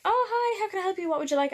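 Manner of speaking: bright high cashier greeting. Retold or imitated. Imitating the McDonald's cashier in a high chirpy voice. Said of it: "And she's like".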